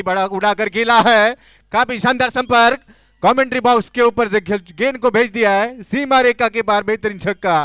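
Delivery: loud, high call of a six; excited cricket commentary. Real, not acted. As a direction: shout the big hit out.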